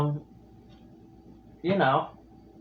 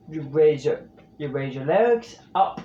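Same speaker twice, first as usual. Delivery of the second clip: ordinary delivery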